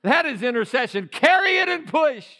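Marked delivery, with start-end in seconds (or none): 1.1-2.3 loud high preaching cry; ringing preacher shout; shouted command again. Acted. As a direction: call it out even harder.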